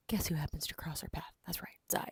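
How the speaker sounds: half-whispered plot reveal; hushed, knowing aside; conspiratorial hush. Real, not acted. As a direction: lean in and share it softly.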